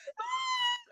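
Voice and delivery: high, strangled crying gasp; sobbing gasp. Retold or imitated. Retold crying over a book.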